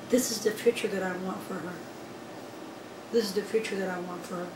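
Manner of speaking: soft, hushed wish for her daughter; quiet hope. Retold or imitated. Mother watching her sleeping daughter, softly hopeful. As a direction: say it to yourself, barely aloud. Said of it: "and I'm like".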